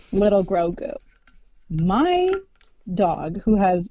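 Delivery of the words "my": ordinary talk, nothing marked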